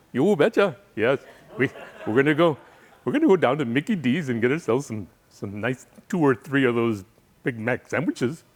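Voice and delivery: put-on Wisconsin local voice; folksy, hammed-up Midwestern accent. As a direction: mimic a chatty Wisconsinite for laughs. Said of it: "they speak with an accent. They, yes, they do".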